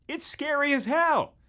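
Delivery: high, loud, drawn-out exclamation; emphatic exclamation. Acted. Host hyping Resident Evil's scares, high emphatic exclamation. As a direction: push it up high and stretch it out. Exclaims how frightening the game is.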